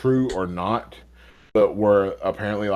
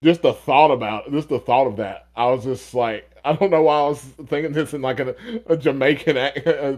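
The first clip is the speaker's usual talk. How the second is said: usual speaking voice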